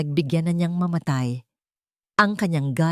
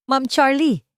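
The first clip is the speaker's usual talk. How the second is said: shocked cry, higher and louder; startled shout; 9 semitones higher, 4 dB louder, slower than usual. A narrator's reading. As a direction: blurt her name in shock, loud and high. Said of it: "Sigaw ni Marisol, gulat na gulat".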